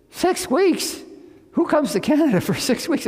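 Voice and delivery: can't believe a visit that short; Six weeks? thrown way up in disbelief; astonished. Retold or imitated.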